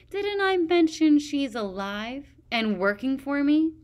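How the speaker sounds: high, loud gloating taunt; malicious. A narrator's reading. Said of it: "said maliciously".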